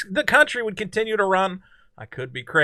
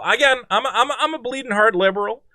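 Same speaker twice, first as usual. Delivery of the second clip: ordinary talk, nothing marked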